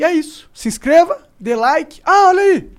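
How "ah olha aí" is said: excited. Real, not acted. Emblem appears on screen.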